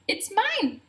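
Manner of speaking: high, chirpy bird voice; high twittering claim. Acted. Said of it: "the bird twittered".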